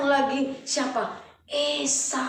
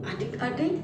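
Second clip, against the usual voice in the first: usual speaking voice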